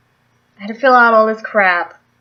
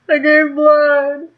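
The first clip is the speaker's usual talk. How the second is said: slow, strained, high and loud; mock battle-weary triumph; 6 semitones higher, 5 dB louder, much slower than usual. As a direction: announce it like surviving a battle, hammed-up. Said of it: "It's like a freaking battle".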